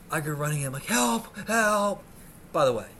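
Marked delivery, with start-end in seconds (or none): 0.9-2.0 high, loud cries; calling for help. Retold or imitated. Injured man running into hospital, calling for help. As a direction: holler as if running in.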